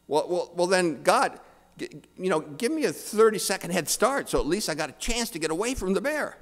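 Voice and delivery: high, rushed, stammering plea; panicked bargaining. Acted. Atheist begging God for a head start, flustered and rushed. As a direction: plead fast for a last chance.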